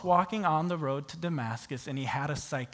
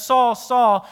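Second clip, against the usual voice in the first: calling out Saul; 7 semitones higher, 12 dB louder than usual. Acted. Retelling Jesus calling out.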